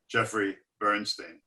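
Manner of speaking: ordinary delivery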